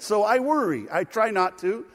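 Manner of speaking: ordinary talk, nothing marked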